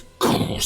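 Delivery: short voiceless crashing noise; imitated gate slam. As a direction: make the crash of a slamming gate. Imitates gates slamming.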